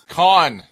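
"Con" for Cannes, one loud syllable with an ah; corrective pronunciation. Shown as a demonstration. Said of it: "I'm just saying it's called Cannes".

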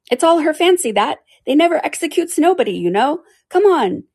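high, loud creature voice; griffin voice. Acted. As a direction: squawk it out like a griffin. Griffin speaks, high pitched.

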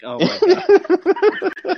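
high, slow, drawn-out oh my God; mock exasperation. Mocking the Good Morning Football take, laughing. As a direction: act out the disbelief, stretched and laughing. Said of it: "I'm like".

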